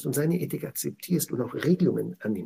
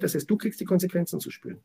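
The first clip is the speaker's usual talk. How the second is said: ordinary talk, nothing marked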